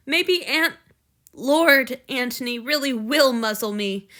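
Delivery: loud, anguished, rising to despair; frantic self-blame. Acted. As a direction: beat yourself up out loud.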